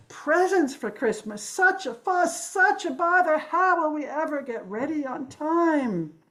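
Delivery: high, drawn-out wailing complaint; frantic yelling. A narrator's reading. Stressed neighbors fretting about getting ready for Christmas, yelling. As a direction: cry it out in a stretched, hammed-up fluster. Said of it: "they yelled".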